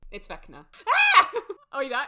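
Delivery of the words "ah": short high shriek of fake fright; mock scream. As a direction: yelp in playful horror. Joking that Vecna took the connection.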